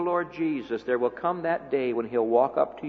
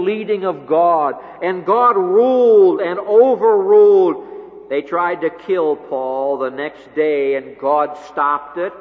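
climactic preaching; 5 semitones higher, 9 dB louder than usual. Acted. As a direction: hammer each line home to the congregation. Climax of sermon.

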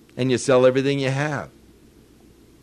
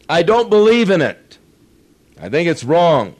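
higher and louder, emphatic pulpit delivery; fervent preaching; 5 semitones higher, 8 dB louder than usual. Real, not acted. Preacher condemning manipulative evangelism to congregation, fervent preaching. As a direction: hammer home each phrase from the pulpit.

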